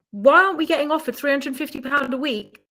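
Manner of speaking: ordinary talk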